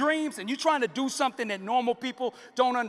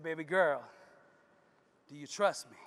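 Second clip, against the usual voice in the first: low and soft; gentle father; 6 semitones lower, 4 dB quieter than usual. Acted. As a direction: reassure your daughter gently.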